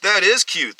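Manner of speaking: high, loud mocking agreement; teasing reply. A narrator's reading. Second bully joins the teasing.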